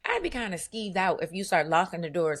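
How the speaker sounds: ordinary talk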